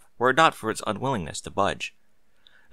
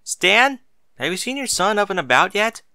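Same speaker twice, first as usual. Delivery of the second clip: high, loud call to another room; 10 semitones higher, 5 dB louder than usual. A narrator's reading. Mary calling to her husband from the bathroom, calling out. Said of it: "Mary called after her husband".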